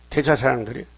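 ordinary talk, nothing marked

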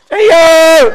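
very high, full-force shout; piercingly high, drawn-out prayer cry. Shown as a demonstration. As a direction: shout it out as loud as you can. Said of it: "더 크게, 더 크게".

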